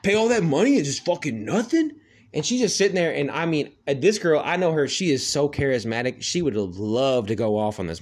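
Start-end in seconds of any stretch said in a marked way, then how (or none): 0.0-1.9 high, outraged complaint; angry customer rant. Retold or imitated. Angry man complaining about the car wash to the clerk, high and outraged. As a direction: whine like a ripped-off customer. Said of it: "And he's like".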